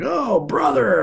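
loud, high hearty "Oh, brother!" from a bear of a man; big man's greeting. Retold or imitated. Giant man slapping his back, hearty high shout. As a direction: boom it out like a big friendly guy. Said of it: "and is like".

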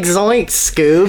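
mock Shaggy panic; Shaggy-style yelp. Joining the Scooby-Doo joke, cartoon panic.

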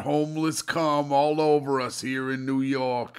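put-on New York guy accent. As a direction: play it like a bad New York local. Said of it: "That's my New York guy".

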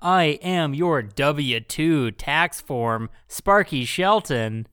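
projected announcer delivery, slowing on the name; podcast host intro. Acted. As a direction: announce the show with showy emphasis. Podcast host reads the show intro.

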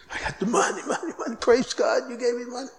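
soft, breathy giggling over money; giggly gloating. Retold or imitated. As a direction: giggle the words out, almost whispered.

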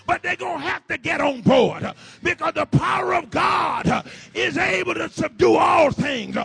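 loud, rising preacher's call; climbing sermon fire; full-force preaching. Acted. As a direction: build the crowd up and shout it. Preaching climax, congregation.

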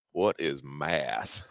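slow, low, hushed puzzlement; retold whisper. Family puzzling in the back seat, imitated hushed slow question. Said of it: "So we're whispering in the back seat".

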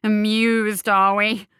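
loud snarling sneer. Acted. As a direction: snarl it out, stung and furious. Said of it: "he snarled".

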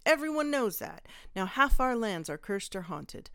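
put-on loud drunken rant; angry rant. A narrator's reading. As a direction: shout out the grievances. Drunk mayor ranting.

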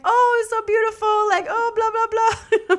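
high, loud mock cooing; gushing grandmas. Grandmas admiring her in the skirt, high gushing imitation. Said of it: "They were basically, like, saying".

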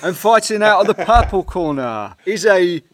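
high, loud fight-night call through laughter; laughing ring announcer. Pretend. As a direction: announce the boxer while cracking up.

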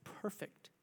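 whispered perfect. Acted. Whispered word.

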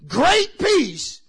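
loud high shouted call to the congregation; preaching at full force. Acted. Preacher rousing the church, full-force shouting. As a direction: whoop it out, stretching words.

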